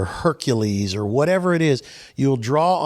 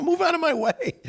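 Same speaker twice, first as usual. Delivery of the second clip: laughing mock command; faster than usual. Telling the doubter to move.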